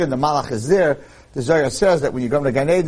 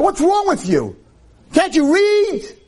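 high, drawn-out jeering disbelief; mocking scorn; 3 dB louder, slower than usual. Angel mocks the man who can't read, high scornful jeer. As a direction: sneer down at him like he's a fool.

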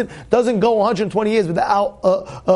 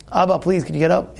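respectful Yaakov manner; 3 semitones lower, faster than usual. Acted. Yaakov asking his father to get up, respectful. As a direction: ask father courteously to rise. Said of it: "Yaakov would say".

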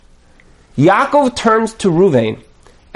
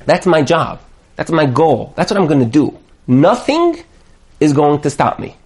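usual speaking voice

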